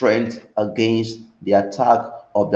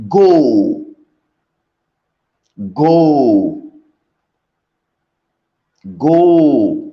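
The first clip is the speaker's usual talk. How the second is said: loud, spaced-out divine command; booming voice of Jehovah, three times; commanding call; 6 dB louder, much slower than usual. Acted. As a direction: send him off with each go. God commands Elijah to go.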